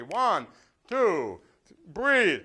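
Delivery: a loud coach's count-in; counting the class into a breath, loud. Real, not acted. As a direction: call the count out loud to the room.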